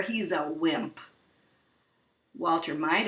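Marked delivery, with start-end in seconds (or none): 0.0-1.0 lightly voiced burglar brushing it off; low sneering crook; low gruff burglar.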